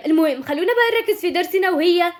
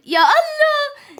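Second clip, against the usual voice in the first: drawn-out cry; 10 semitones higher, much slower than usual. Acted. Panicked about being late.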